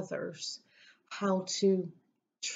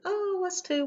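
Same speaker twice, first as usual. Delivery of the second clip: surprised; 12 semitones higher than usual. Retold or imitated. Retold surprise at shared home state.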